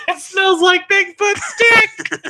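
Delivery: high, slow laughing yell; laughing shout. Real, not acted.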